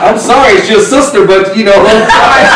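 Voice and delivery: high, laughing mock apology. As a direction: laugh through the fake apology.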